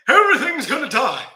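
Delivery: wife panicking over the muddy tank, high and loud; imitating wife panicking. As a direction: ham up the wife's alarm over the fish. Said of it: "Wendy comes in and she's like".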